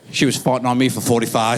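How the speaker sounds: normal talking voice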